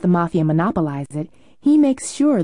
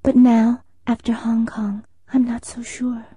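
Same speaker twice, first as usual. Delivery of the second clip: hushed doubt; 13 semitones higher, 4 dB louder than usual. Acted. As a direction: drop to a whisper on the doubt. Doubts whispered after Hong Kong.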